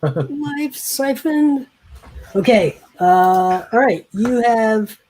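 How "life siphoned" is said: high, drawn-out echo through laughter; amused echo. Real, not acted. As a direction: laugh and stretch the words out, pitched high. Laughing echo of player's phrase.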